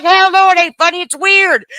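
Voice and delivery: loud, high mock husband shouting no; husband's loud refusal. Wife imitating husband's loud disgusted refusal. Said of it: "He's like".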